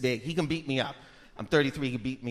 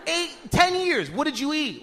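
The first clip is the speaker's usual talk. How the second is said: exasperated, higher-pitched yell at mom; worked-up son, pitched up; retold yelling; 6 semitones higher than usual. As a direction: act out yelling at your mother. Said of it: "yelling at this lady".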